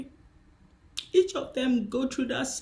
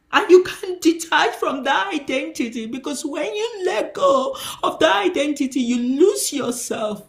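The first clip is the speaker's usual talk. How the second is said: preaching at full force, louder and higher; rising preaching; 8 semitones higher, 8 dB louder than usual. Acted. Preacher pressing the church about shame, rising to loud preaching. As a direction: build the sermon to a loud peak.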